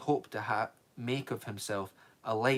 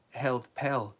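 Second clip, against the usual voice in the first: ordinary delivery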